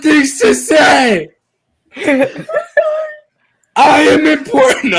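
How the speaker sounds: slow, high, booming mock tyrant; dark lord. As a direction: proclaim it like a laughing villain king. Pompous mock ruler rant, laughing.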